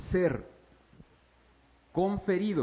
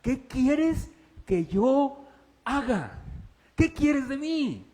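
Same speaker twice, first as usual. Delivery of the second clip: high, slow plea; pleading Saul; 7 semitones higher, 4 dB louder, much slower than usual. Acted. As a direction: beg for orders. Saulo pregunta al Señor. (súplica aguda y lenta)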